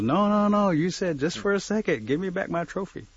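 storybook indignation, urgent and high; acted protest. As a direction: demand it back as an indignant turtle. Turtle protests, demands trophy back.

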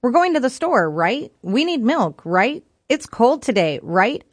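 each sentence capped with a rising "right?"; every "right" lifted as a little question; tag-question habit. Retold or imitated. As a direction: imitate a habit of tacking on "right?". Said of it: "ending every sentence with 'right.'".

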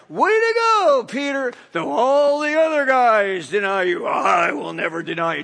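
loud mocking shout of praise; Jesus loudly mocking Peter's boast; sarcastic jab. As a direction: hurl his boast back at him, sneering. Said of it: "it's like".

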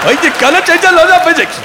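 garbled mock-Scottish accent, high and loud; high, excited bad Scottish accent; Scotty. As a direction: gabble in an overdone, near-unintelligible Scottish accent. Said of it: "Scotty in Star Trek".

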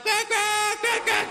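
very high, wailing quacks; piercingly pitched comic duck cries; female duck quacking. Retold or imitated. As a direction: quack like a distraught hen duck. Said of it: "and the female's like".

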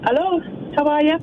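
a hint of Irish in his voice; Irish accent. Real, not acted. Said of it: "you've got an accent now".

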